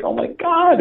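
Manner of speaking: retold high-pitched panic; freaking out. Minister suddenly sees Satan in his session, mock freak-out. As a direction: imitate someone freaking out, voice jumping up high. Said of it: "was totally freaking out".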